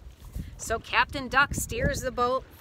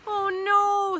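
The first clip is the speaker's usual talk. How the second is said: high, loud wail of alarm; storybook scream; 10 semitones higher, 6 dB louder than usual. A narrator's reading. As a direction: wail it in mock panic. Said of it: "screams Sheep".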